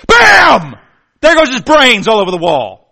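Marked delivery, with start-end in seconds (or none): 0.1-0.8 much higher, much louder shouted bang; loud mock gunshot cry. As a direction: blast out the gunshot. Gunshot in the illustration.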